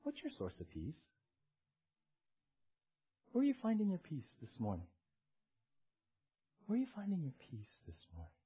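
soft, gentle questioning, with room between questions; loving. Acted. God asks people where they find peace, loving. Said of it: "When God lovingly says".

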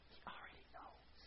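hushed whisper to the congregation. Real, not acted. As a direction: lean in and whisper it. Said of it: "sudden whisper".

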